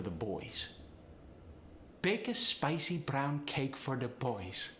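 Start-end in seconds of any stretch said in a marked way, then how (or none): 2.0-4.7 slow, higher Polish accent. Shown as a demonstration. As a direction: Polish accent, diphthongs lifting upward. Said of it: "upward inflection naturally".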